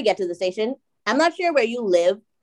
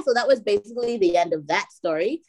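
ordinary delivery

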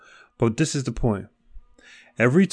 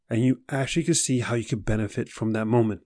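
usual speaking voice

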